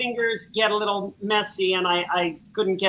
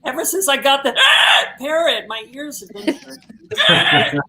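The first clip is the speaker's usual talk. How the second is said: higher voice broken by parrot squawks; parrot squawk gag; 5 semitones higher, 4 dB louder than usual. Pretend. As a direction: talk through sudden mock parrot screeches. Joke parrot squawks, feigning damaged ears.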